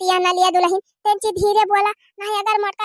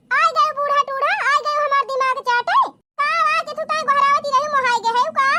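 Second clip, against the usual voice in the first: annoyed retort; 6 semitones higher than usual. Acted. Daughter-in-law snaps at mother-in-law.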